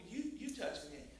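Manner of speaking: hushed, gentle address; quiet, compassionate voice; kind, tender. Acted. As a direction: speak softly and kindly to someone trembling. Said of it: "he just treats you so kindly".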